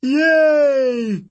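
high, loud, joyful shout; excited cheer. Acted. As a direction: cheer like a thrilled child. Tyson cheering at the ship.

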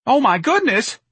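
very high, loud squeak; squeaked dismay. A narrator's reading. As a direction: squeak it in a panic. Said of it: "Mister Gonzalez squeaked".

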